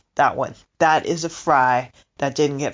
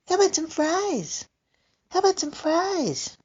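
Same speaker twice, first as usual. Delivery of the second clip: cooing to the cat; 10 semitones higher than usual. Retold or imitated. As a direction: coax the cat like a baby, up high. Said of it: "And I'll be like".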